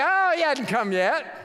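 high, lightly scoffing retort; mock scoffing. Preacher imitating his younger self after the phone call, scoffing. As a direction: play up a relieved scoff. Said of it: "But I'd scoff".

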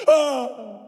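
hammed-up guilty bawling; wailing child. Son overheard from his room confessing, mock wailing. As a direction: wail it out like a sobbing little boy.

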